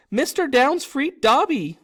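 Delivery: grateful elf squealing shrilly up at him. Acted. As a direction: squeak it out in wonder. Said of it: "said the elf shrilly".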